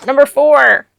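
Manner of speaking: loud, high shout; excited announcement. Real, not acted. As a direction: belt it out as a big reveal. Excited reveal of last bag.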